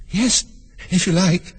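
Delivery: put-on high, light girl's voice; high-pitched storybook Alice; agreeable girl. A narrator's reading. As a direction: answer politely as a small girl.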